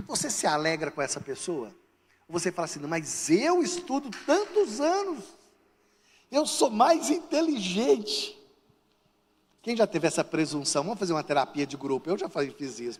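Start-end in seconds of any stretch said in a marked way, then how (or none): 2.8-8.4 higher, drawn-out mock grievance; envious protest. Jealous person reacting to another's exam success, mock indignant. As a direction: whine it out slowly, hammed-up.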